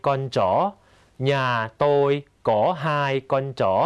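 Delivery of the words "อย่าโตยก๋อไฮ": อย่าโตยก๋อไฮ said as clear Vietnamese with rising end; Vietnamese phrase. Shown as a demonstration. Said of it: "teacher repeats model". (ออกเสียงภาษาเวียดนามให้ผู้เรียนฟัง)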